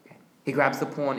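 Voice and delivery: ordinary talk, nothing marked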